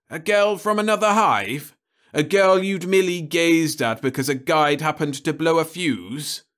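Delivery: put-on can't believe the boy; incredulous official. Crumbine incredulous.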